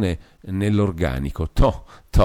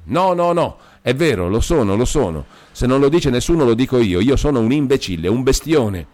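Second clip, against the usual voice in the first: high, louder self-accusing outburst; crying out; 6 semitones higher, 4 dB louder than usual. A narrator's reading. Demetrio cuts off Beatrice's objection, crying out. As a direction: cry it out, insisting against all protest. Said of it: "egli gridò".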